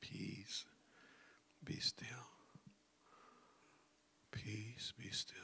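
soft whispered calm; whispered self-talk. Shown as a demonstration. Self-talk to calm your own mind, whisper. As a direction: whisper it to yourself. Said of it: "that self-talk".

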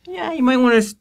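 normal talking voice

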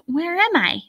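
high, bewildered question; high-pitched worried wondering; lost little rabbit. A narrator's reading.

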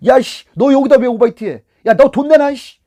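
loud, high-pitched angry demand; angry confrontation. Shown as a demonstration. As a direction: blow up at the passenger. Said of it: "본인이 화를 내고 막".

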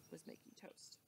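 hushed whisper. A narrator's reading. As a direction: drop to a secret whisper. Suspense in the story.

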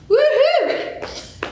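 high whooping cheer; excited cheer. Real, not acted. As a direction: whoop to pump up the class. Instructor cheers mid-workout.

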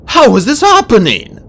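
high, loud, bewildered cry; agitated high-pitched exclamation; sudden outburst. A narrator's reading. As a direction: burst out in disbelief. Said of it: "Starflight burst out".

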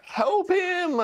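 high-pitched cry for help; urgent high plea; desperate yell. Real, not acted. Yells at the screen.

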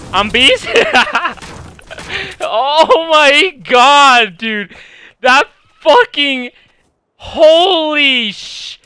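hyped screaming with laughter; excited yelling. Real, not acted. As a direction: yell it out, totally pumped. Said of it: "'cause I yelled so hard".

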